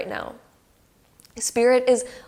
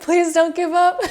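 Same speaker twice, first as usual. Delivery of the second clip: high, eager plea, a little louder; pleading Spirit; 8 semitones higher, 6 dB louder than usual. Retold or imitated. Spirit urging the querent, high and eager. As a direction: beg them not to quit. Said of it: "coming through being like".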